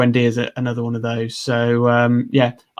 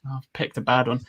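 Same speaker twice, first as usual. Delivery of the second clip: ordinary delivery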